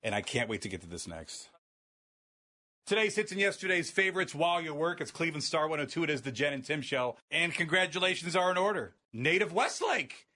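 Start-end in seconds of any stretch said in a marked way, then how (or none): 2.9-8.9 bright radio imaging read; station ID announcer voice. Acted. 9.1-10.3 drawn out and celebratory; slow triumphant announcement. Real, not acted.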